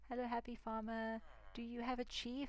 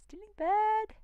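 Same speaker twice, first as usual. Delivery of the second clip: much higher and louder, blunt caveman voice; caveman blurt; 14 dB louder, slower than usual. Acted. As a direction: bellow it like a simple caveman. Sums up, then repeats it comically.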